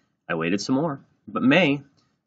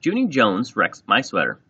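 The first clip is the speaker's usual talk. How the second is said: grouchy, louder complaint to the teacher; 4 semitones higher, 7 dB louder than usual. A narrator's reading. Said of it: "she grouched".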